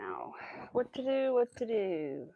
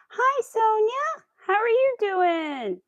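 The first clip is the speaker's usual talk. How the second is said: high, loud, drawn-out hello; lifted, stretched-out welcome; sing-song greeting; 11 semitones higher, 7 dB louder, much slower than usual. Real, not acted. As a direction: call out slowly to a friend who just arrived. Calling greeting to arriving viewer.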